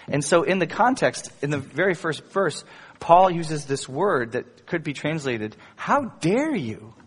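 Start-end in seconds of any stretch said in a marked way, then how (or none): 5.8-6.9 slow, higher-pitched scolding; indignant scolding, drawn out; strong scold. Retold or imitated. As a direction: scold them slowly, leaning on DARE. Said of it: "really, really strong scold".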